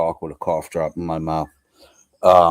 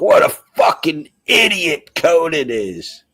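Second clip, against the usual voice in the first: mock viewer voice; 9 semitones higher, 6 dB louder, slower than usual. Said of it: "People are just like".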